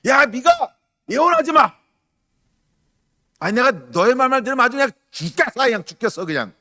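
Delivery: loud, high-pitched irate outburst; hammed-up furious yelling; put-on anger. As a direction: blow up theatrically at someone who bugs you. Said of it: "일부러 화를 막 내는 거예요".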